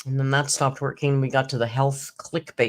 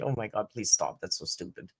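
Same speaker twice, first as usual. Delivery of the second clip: embarrassed; 8 semitones lower, 8 dB quieter than usual. Retold or imitated. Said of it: "I was like".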